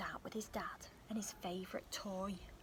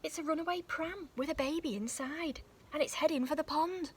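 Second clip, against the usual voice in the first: higher, louder and speeding up; rising alarm; 6 semitones higher, 6 dB louder than usual. Acted. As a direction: let the danger build. Pram rolling to the pond.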